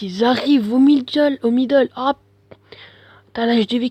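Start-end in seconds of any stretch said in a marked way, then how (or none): 0.0-2.2 excited. Real, not acted. Excited game commentary, repeated.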